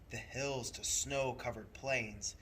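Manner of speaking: ordinary delivery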